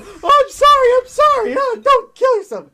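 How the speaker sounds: shrill parody of coddling fans; mocking sympathizers. Streamer parodying people who pity the suicide baiter, high whine. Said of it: "all these people".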